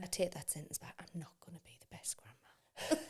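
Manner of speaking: soft whispered retraction. Real, not acted. Retracting her grandma boast to her friend, whispered. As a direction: take it back in a guilty whisper.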